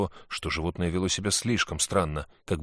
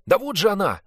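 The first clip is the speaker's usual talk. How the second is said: put-on higher, louder excited boy; boy's voice; 11 semitones higher, 8 dB louder than usual. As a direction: play a cheeky lying boy. Sid points at the sloth.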